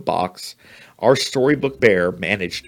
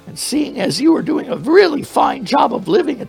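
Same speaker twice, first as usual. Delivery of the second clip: extremely high, louder squeaky mouse voice; wailing mouse; 5 dB louder than usual. Acted. Mouse warning the bear against new stuffing, high wailing squeak. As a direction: wail it up high like a frightened mouse. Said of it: "the mouse wailed".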